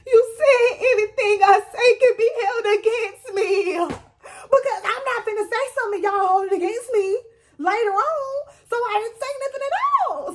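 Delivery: high-pitched tearful wail, imitating herself; mock crying. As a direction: whine it out as if sobbing.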